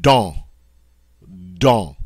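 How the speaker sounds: "don" with an exaggerated nasal vowel, silent N; French nasal vowel. Shown as a demonstration. Teacher gives French example word, exaggerated nasal vowel. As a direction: sound "don" like bond stopped before the N. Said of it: "it's more pronounced, more exaggerated".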